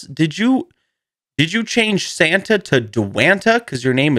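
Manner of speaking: high, slow questions broken by laughs; mock the pun in disbelief; laughing disbelief. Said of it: "And I said".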